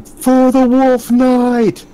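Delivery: high, loud, drawn-out war cry; battle scream. Acted. Game master voices attackers jumping out with weapons ready, screamed battle cry. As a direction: scream it slowly as a rallying cry. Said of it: "they just scream".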